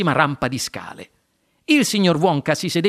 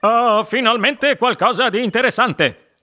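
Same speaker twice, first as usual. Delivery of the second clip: higher, put-on character voice; keen father; 5 semitones higher, 3 dB louder than usual. A narrator's reading. Mr Salt spotting liqueur chocolates, put-on eager voice. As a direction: show keen interest at last.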